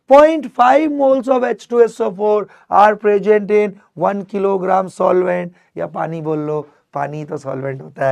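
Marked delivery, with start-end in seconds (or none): 0.1-5.5 high, loud, slow recital; loud answer. Shown as a demonstration. Teacher modelling the class answer aloud. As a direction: chant the answer for the class to repeat. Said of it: "मतलब बोल".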